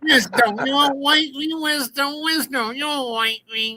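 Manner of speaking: high-pitched mocking impersonation; Bud. Billy mocking a rival's own words, high impression. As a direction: mimic him in a high, silly voice. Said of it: "play it in his own words".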